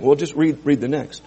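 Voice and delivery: ordinary talk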